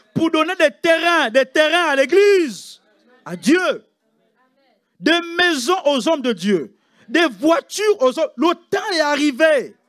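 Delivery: loud, high and slow preacher's cry; preaching at full force. Acted. Preacher rallying the church to give land, full-force preaching. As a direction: hammer each phrase to the crowd.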